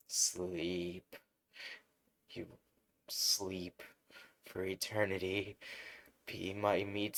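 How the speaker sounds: soft, sinister lullaby; lightly voiced hypnotic villain. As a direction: coax him to sleep, then slow down on meat suit. Villain smothering Deku to sleep.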